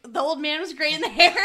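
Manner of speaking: loud, high Irish brogue; Irish accent. Retold or imitated. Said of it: "try to do the accent".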